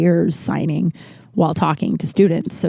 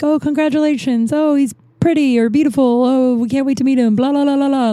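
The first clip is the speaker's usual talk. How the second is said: high, gushing baby compliments, imitated; mock congratulations; 6 semitones higher than usual. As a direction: coo stock praise then dissolve into blahs. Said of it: "whatever the bullshit you say".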